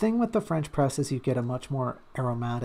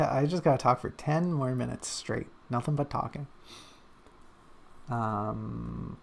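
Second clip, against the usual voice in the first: usual speaking voice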